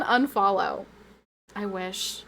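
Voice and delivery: ordinary talk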